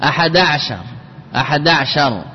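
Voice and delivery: ع of عشر with no vowel, leaning on أحد; silent ayn. Shown as a demonstration. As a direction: say أحد عشر as "aḥada‘-shar". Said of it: "بإسكان العين". (نطق عشر بإسكان العين)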